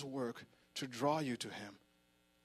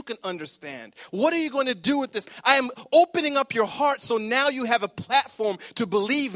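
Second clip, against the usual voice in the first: louder, higher, God calling out by name; voicing God, preaching loud; 7 semitones higher, 11 dB louder than usual. Preacher voicing God to Nick, loud and urgent. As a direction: ring it out as God pleading with them.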